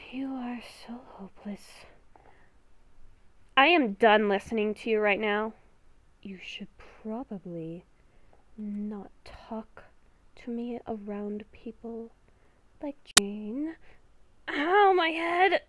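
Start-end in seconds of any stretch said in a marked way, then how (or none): 0.1-1.6 beast within voice. Acted. Inner beast whispering a warning to [name], hushed and ominous. 6.2-13.8 beast within voice. Acted. Inner beast whispering a warning to [name], hushed and ominous. 14.5-15.6 ow, louder and higher, hurting; pained cry. Real, not acted. [name] feels the beast in her head, pained cry. Sudden headache.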